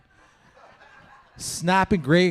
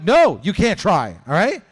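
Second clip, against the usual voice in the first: louder, slow flustered no; firm refusal; 4 semitones higher, 8 dB louder, slower than usual. Retold or imitated. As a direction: shut it down fast. Uncle refuses nephew.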